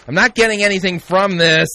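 loud, high exasperated complaint; hammed-up exasperated wail; mock frustration. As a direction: cry out as if the meditation has failed. Mock meditation fails, he gives up.

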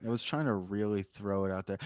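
normal talking voice

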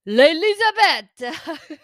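high, slow, loud Italian teacher saying "L'Elisabeth"; Italian-French accent. Retold or imitated. Vlogger imitating her French teacher calling roll, Italian accent. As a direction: draw out "L'Elisabeth" like an Italian woman speaking French. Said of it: "in French with a Italian accent".

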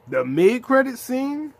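overwhelmed fan shouting the scene's name; hyped. Real, not acted. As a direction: yell it in disbelief.

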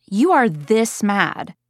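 slow, drawn-out emphasis on THIS; dramatic validation. Acted. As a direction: stretch it out to match a child's fury. Mother showing son his anger.

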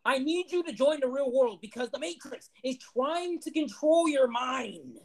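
high, slow, dramatic warning voice; hammed-up prophet of the Matrix; mock conspiracy preacher. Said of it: "mock conspiracy voice".

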